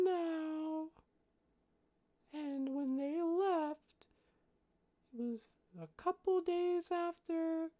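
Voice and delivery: put-on high, slow, thinking-it-out kid voice; little child working out the dates; small child. Young halfling telling when friends left, slow high child voice. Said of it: "this child cannot".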